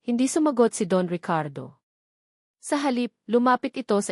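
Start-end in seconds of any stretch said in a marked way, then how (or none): none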